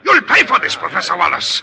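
sharp, rising furious threat. Acted. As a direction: spit out the threat. Threat in quarrel.

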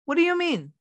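high, puzzled put-on voice; mock clueless mother. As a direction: play the distracted mom who isn't listening. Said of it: "the mom is heard saying".

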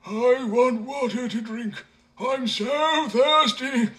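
high, very slow parched plea; thirsty man moaning to the simpleton. A narrator's reading. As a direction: drag out the thirst. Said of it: "replied the man".

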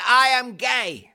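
slow and high; fisherman voice. Retold or imitated. Fisherman's coming out, quoted.

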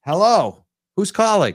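high, loud hello to caller; bright on-air greeting. Real, not acted.

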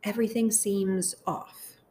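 guided meditation. Acted. Host praying phrases aloud for listeners to repeat, guided meditation. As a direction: lead them gently through it, phrase by phrase, unhurried.

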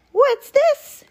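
very high, loud rabbit squeak; high-pitched excited little rabbit; delighted squeak. A narrator's reading. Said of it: "he squeaked with delight".

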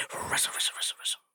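soft breathy rustle rustle; rustling whisper. Shown as a demonstration. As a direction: whisper it like leaves in wind. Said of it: "Can you do a rustling sound?".

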